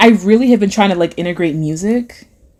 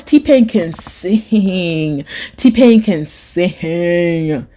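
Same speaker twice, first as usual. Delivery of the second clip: slow drawn-out; 2 semitones lower, much slower than usual. Acted. Slow drawn-out reading of chat.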